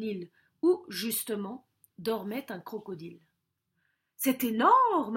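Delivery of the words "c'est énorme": "énorme" stretched long and loud; awed stress on "énorme". Acted. César sees the crocodile, "énorme" long, loud and awed. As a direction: draw out "énorme" in amazement.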